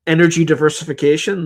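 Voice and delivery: ordinary delivery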